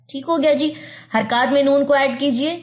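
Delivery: usual speaking voice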